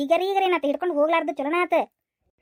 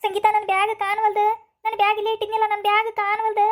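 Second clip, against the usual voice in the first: panicked; 5 semitones higher than usual. Acted. Woman discovers her bag stolen.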